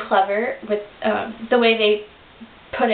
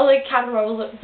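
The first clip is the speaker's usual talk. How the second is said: normal talking voice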